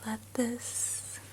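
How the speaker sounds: fading sleepy whisper, slowing down; soft spa whisper. Acted. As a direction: lull them to sleep in a hush. Lulling viewer to sleep.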